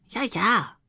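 put-on lightly high, brushing-off child voice; a hint of a dismissive boy. A narrator's reading. Max brushes off his mother in a lightly high child voice. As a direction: wave him off like a bored little boy.

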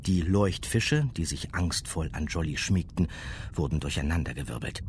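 ordinary delivery

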